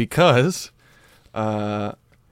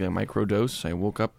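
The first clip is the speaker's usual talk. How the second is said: ordinary talk, nothing marked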